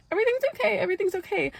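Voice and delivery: high, soothing fake calm; reassuring older sister. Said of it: "trying to be like".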